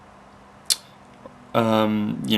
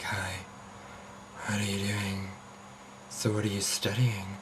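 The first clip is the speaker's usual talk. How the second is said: flirty caller voice; 13 dB quieter than usual. Retold or imitated. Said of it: "people start to flirt".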